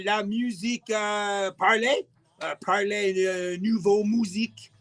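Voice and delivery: halting attempt at a French accent; put-on French, searching for words. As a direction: fumble through it in amateur French. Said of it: "How do you say that in français".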